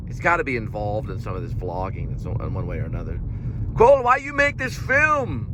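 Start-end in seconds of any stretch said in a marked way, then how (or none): 3.8-5.5 high put-on accent that slips into Tommy Wiseau; mock German filmmaker voice; failed Herzog impression. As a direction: ham it up as a baffled auteur. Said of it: "It sounds like Tommy Wiseau".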